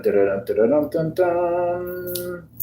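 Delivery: ordinary talk, nothing marked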